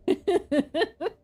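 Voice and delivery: bright high giggle; a quick amused laugh up high. Real, not acted. Laugh after bald man joke.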